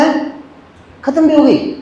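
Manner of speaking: high, startled mock disbelief; surprised disbelief. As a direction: act stunned the film already ended. Retold surprise at movie ending. (चौंककर हैरानी)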